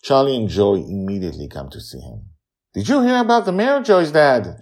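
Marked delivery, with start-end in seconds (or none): 2.7-4.6 put-on high, loud and fast eager child; bursting to tell the news; excited. A narrator's reading. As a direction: Charlie rushes to Justin, excited and high. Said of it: "asks with excitement".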